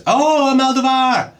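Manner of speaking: high squeal, then a fast gush; excited squeal. Real, not acted. Finding an Almodovar film, high fast fan squeal.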